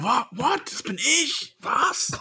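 high, thrilled disbelief; excited surprise. Real, not acted. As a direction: squeal it with delight. [name] realises he is meant.

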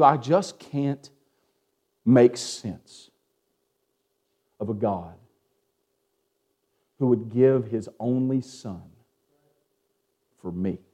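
slow, low, dropping to a hush; preacher softening over the sacrifice; hushed awe. Acted. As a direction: let the voice sink in wonder. Preacher in awed reflection.

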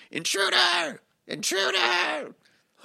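high-pitched mock alarm cry; squeaky-high impression of someone yelling a warning; imitating friend's voice. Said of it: "let me try and do your voice".

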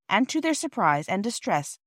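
usual speaking voice

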